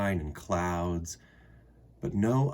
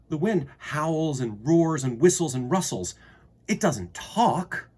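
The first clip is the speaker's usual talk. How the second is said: higher, louder, the last words slow; indignant protest; 6 semitones higher, 6 dB louder than usual. Acted. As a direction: insist the wind can't talk. Girl protests to the wind.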